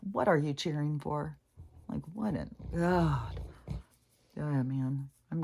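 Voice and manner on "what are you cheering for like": normal talking voice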